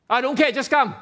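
loud, high, dismissive bark; rude demand. Shown as a demonstration. Preacher mocks a rude invitation, loud and dismissive.

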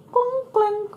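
high clanking sounds; clunk clink again. A narrator's reading. Narrator repeats the ski lift clanking. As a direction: echo the lift noise.

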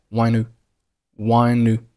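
"one u" run together, the n gliding into u; linked "one u". Shown as a demonstration. Teacher drilling the link, "one u" joined in one piece. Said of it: "one和u就连读成了".

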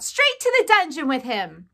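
loud, high, falling demand; crying out. A narrator's reading. Mouse condemning Despereaux, crying out. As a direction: call for punishment from the crowd. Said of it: "a voice cried out".

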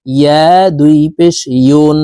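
ইয়া voiced from the middle of the tongue. Shown as a demonstration. Teacher showing viewers the ya sound from the tongue. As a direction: say ইয়া দুই পেশ ইউন from mid-tongue, slowly. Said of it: "ইয়ার উচ্চারণ জিহ্বার মধ্যখান থেকে".

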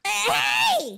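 sharp, high-pitched "hey"; high indignant cry; protesting shout. Acted. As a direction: yell out in protest. Protests at Wi-Fi being pulled.

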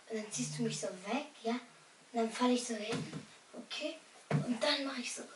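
ordinary talk, nothing marked

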